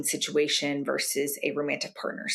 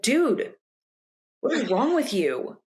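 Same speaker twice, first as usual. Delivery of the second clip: exasperated; 7 semitones higher, slower than usual. Retold or imitated. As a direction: blurt it out in frustration. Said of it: "That's not gonna be very helpful".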